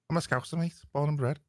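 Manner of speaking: put-on a light Scouse accent, proud. Scouse accent, telling the American co-host where he's from. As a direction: claim Scouse roots in a Liverpool accent. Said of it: "I know it doesn't sound like it".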